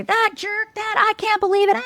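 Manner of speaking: high, exasperated mock rant about a jerk; mock venting outburst. Venting to a best friend, mock outraged and high. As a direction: let it spill out like unloading on a friend.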